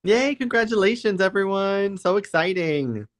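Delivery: high, celebratory cheer, slowing at the end; high-pitched, drawn-out delight; excited congratulations. Real, not acted. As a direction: cheer for all the winners, voice lifted high. Congratulating the winners.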